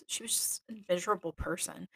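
ordinary talk, nothing marked

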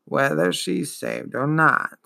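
slow, dragging, wheezing ancient voice; wheezing old turtle. Acted. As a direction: drawl it out like a weary old beast. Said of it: "Morla wheezed".

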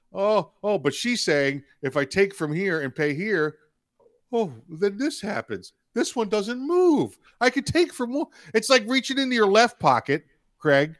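squeaky, incredulous mock of her logic; mock high voice. Host mocks Pelosi's money logic to co-host, high mocking voice.

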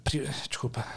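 unvoiced whispered apology. Real, not acted. Whispering an apology to his cohost mid-conversation. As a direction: whisper the sorry under your breath.

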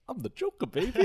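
high, hammed-up Joker line. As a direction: tease it like the Joker. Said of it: "I was like".

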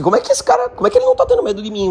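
high, fast, baffled tiger voice; puzzled tiger thinking. Acted. Tiger wondering why the cat isn't scared, high baffled voice. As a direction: squeak it out as a bewildered big cat. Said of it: "pensou assim".

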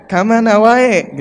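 hammed-up Indian film expressiveness, drawn out; Indian film impression. Shown as a demonstration. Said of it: "Sok ningali film India jika natenya". (gaya film India, lantang dan mendayu)

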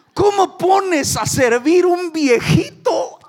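high, slow, drawn-out indignant shout; outraged shout. Acted. Preacher protesting to the church, a slow high shout. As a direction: cry it out as if scandalized. Preacher mocks God's choice.

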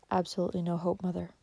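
quiet grave. A narrator's reading. Doctor's grave verdict.

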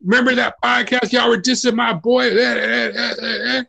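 high, gloating fan voice; mocking Twitter fans. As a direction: crow like a smug fan, then fake cry. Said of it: "they're gonna be like".

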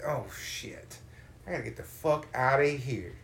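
normal talking voice

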